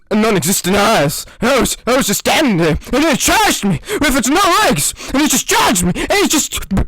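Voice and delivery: hammed-up trauma tale building to panic; mock war veteran. Fake war story of candle.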